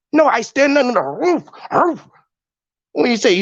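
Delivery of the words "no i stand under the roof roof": higher-pitched answer ending in a woofed "roof"; insist, then bark "roof" like a dog; barking reply. Acted. Answering those who ask why he's barking, with a high barked "roof". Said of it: "why the fuck the boy barking".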